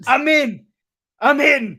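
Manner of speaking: high triumphant shout; triumphant hacker. Acted. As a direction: announce the hack loudly. Acted hacker voice.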